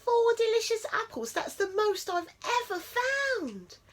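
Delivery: high squeaky mouse voice, thrilled; excited mouse. A narrator's reading. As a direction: chirp it as a tiny delighted mouse.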